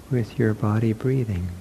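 ordinary talk, nothing marked

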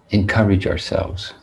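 ordinary talk, nothing marked

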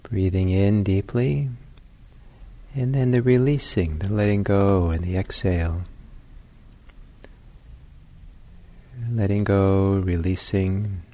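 unhurried, spacious guidance, letting each phrase settle; guided meditation. Real, not acted.

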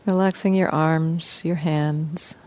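soft, even voice with long pauses; guided meditation. Real, not acted. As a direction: gently guide listeners into relaxing, leaving space between phrases. Leads a guided body-scan meditation.

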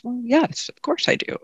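lightly voiced reviler, high and soft; a hint of the insulter, softly surprised; reviler's reply. The teacher voices the reviler answering the Buddha, softly.

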